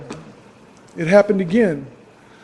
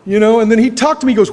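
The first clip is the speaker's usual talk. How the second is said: ordinary talk, nothing marked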